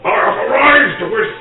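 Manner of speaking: high, slow, pompous Major; pompous officer voice. Acted. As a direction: puff up like a stuffy officer.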